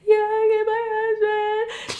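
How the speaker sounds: high, loud sing-song crying wail; mock boo-hoo wail. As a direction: wail it like a hammed-up sobbing kid. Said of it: "I was just boo-hoo crying".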